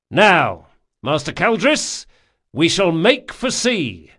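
loud, firm captain addressing crew; rally the men from the deck; commanding captain. A narrator's reading. Locke orders the helmsman.

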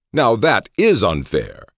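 slow, high-pitched objection; drawn-out affronted complaint; indignant protest. A narrator's reading.

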